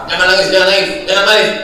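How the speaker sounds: ordinary talk, nothing marked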